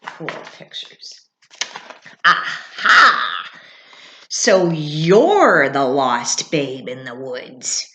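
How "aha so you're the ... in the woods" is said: loud, lower, slow cardinal; announcing cardinal. Acted. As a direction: proclaim it like a herald. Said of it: "announced the cardinal".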